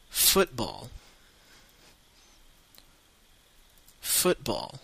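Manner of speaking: football in a British accent, FOOT-ball; football with an English accent, not the teacher's French one. Shown as a demonstration. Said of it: "avec un accent anglais, pas comme le mien".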